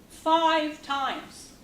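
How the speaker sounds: FIVE times, punched high and loud, then falling; anguished emphasis. Acted. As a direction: hammer "Five times" home as a painful refrain. Grim testimony, count repeated emphatically.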